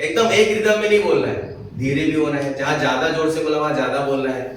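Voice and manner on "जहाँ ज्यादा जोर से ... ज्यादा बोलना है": ordinary talk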